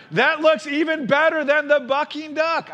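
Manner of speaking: put-on high, loud, excited kid voice; shouting little brother. A narrator's reading. Brother cub cheering for the duck ride, excited shout. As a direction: yell it like an eager cub. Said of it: "shouted brother".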